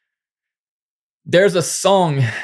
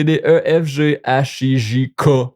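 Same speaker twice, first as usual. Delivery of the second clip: ordinary talk, nothing marked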